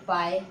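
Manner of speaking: "पाए" with a long aa before the e; wrong pronunciation. Shown as a demonstration. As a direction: sound out "पाए" as paa-e. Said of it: "गलत है". ("पाए", आ की आवाज़ के साथ)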